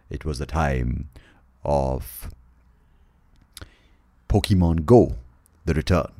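solemn doom-laden storyteller with weighty pauses; mock-epic narration. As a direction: intone it like an apocalyptic saga. Mock-epic trailer narration resumes.

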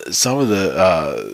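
normal talking voice

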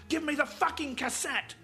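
high, loud, enraged demand; full-throated angry yell; furious scream. Acted. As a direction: scream it as an order. Gunman demands the tape.

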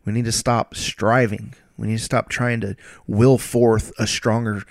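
normal talking voice

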